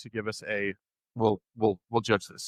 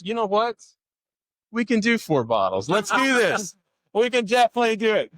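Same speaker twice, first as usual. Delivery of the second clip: excited; 11 semitones higher, 5 dB louder than usual. Real, not acted. Host excitedly agrees to prizes.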